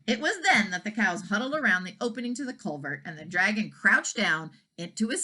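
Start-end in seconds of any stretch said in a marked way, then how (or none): none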